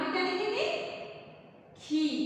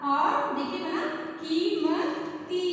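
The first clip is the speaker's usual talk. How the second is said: slow stretched reveal; much slower than usual. Shown as a demonstration. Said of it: "teacher spelling out answer".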